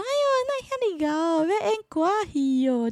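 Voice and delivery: high, exaggerated auntie voice on "哎呦"; nosy elder relative. Elderly relative at New Year greeting the performing-arts student, high gushing voice.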